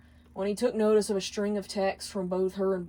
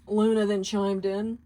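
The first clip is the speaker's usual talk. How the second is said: usual speaking voice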